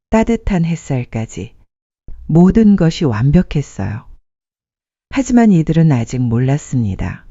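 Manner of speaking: usual speaking voice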